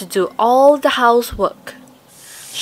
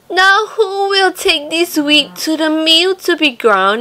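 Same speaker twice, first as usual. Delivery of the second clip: higher hen voice asking; 7 semitones higher, 4 dB louder than usual. A narrator's reading. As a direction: ask like a patient little hen. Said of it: "the little red hen asked".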